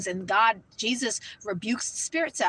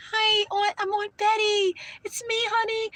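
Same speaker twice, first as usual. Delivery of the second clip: much higher, sugary auntie voice; demon faking a sweet aunt; 11 semitones higher, 4 dB louder than usual. Retold or imitated. Demon pretending to be her dead aunt, syrupy high voice. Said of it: "pretend to be her aunt that passed away".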